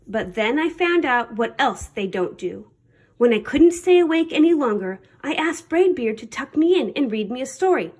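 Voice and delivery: usual speaking voice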